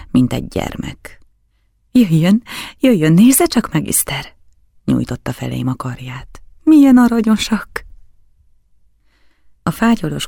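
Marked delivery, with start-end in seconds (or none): 1.9-4.3 high, loud eager call; childlike delight. A narrator's reading. Count calling his guest to see the dogs, delighted. 6.6-7.8 high, loud gushing exclamation; childlike delight. A narrator's reading. Count admiring the little costumed dogs, delighted.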